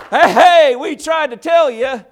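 high, loud mocking call; Wisdom taunting. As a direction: jeer like wisdom laughing at a fool. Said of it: "Wisdom is laughing, saying".

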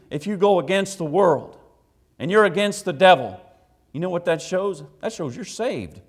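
forceful preaching. Real, not acted. Preacher telling congregation they are saved, forceful preaching. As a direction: preach it out with full conviction.

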